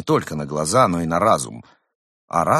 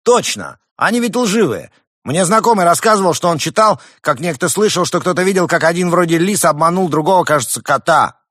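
high, loud eager shout; 9 semitones higher, 7 dB louder than usual. Acted. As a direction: jump in with zeal. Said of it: "Смелый голос из зала".

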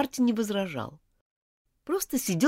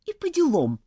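curt grumble; 6 semitones higher than usual. Acted. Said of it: "буркнула Элизабет".

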